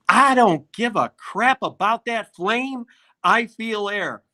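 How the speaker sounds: mocking the singer's demand; imitating angry diva. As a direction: play the outraged star. Voices furious star diva quote.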